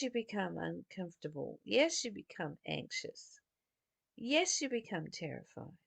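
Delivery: ordinary delivery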